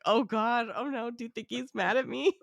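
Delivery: high, fretful mock worry; mock panicked. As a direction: play the anxious guest worried he's in trouble. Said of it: "His reaction to that was so good".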